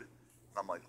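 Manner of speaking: ordinary talk, nothing marked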